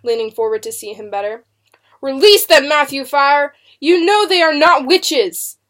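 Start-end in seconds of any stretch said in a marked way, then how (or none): 2.0-5.6 high, loud, impassioned plea; passionate cry. A narrator's reading. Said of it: "Mister Good cried passionately".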